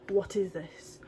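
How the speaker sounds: soft whispered "What is this?". Shown as a demonstration. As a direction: breathe it out, hushed and unvoiced.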